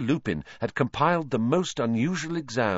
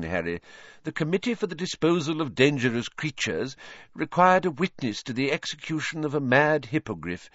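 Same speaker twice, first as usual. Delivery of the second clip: higher, hearty minister voice; Fudge; 5 semitones higher than usual. A narrator's reading. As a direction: greet him with jovial bluster.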